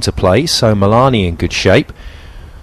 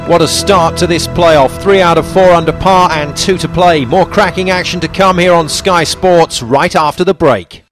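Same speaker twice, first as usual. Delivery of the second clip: excited; 8 semitones higher than usual. Real, not acted. Upbeat sign-off before ad break.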